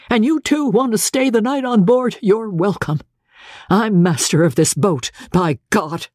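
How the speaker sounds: high-pitched, emphatic captain; captain's shouted orders. Acted. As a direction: lay down the law in a high voice. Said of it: "at the top of his voice".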